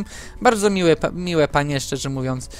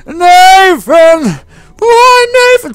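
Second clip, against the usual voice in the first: very high, very loud mock-grieving scream; full-voice acted wail; 14 dB louder, much slower than usual. Imitating her acting a mother whose son died, full-voice scream. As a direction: imitate an actress crying out at full voice. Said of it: "z całego głosu w tym parku".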